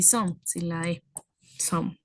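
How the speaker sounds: some said as one syllable, no final E sound; English some. Shown as a demonstration. Teacher models some without the final E for students. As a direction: clip some short, silent E. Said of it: "Se pronuncia así".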